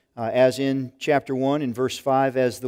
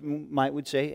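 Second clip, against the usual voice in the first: ordinary delivery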